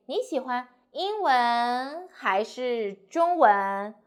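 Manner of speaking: slow, 英文 goes up, 中文 drops; rising then falling choice. Shown as a demonstration. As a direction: lift the first choice, let the last fall. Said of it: "we just go up and the second one go down".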